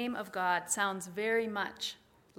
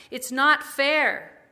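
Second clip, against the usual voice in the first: loud, slow, pitched-up cry of protest; lamenting shout; 5 semitones higher, 12 dB louder, much slower than usual. Pretend. Pastor voicing a people's lament, loud slow shout. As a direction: cry it out slowly against injustice. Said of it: "lamenting, shouting".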